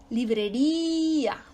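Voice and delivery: "librería" slow, with RÍ held long; stress on RÍ. Shown as a demonstration. Teacher giving examples of written accent, stress on RÍ. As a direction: draw out the RÍ of "librería". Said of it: "ahí cae la sílaba tónica".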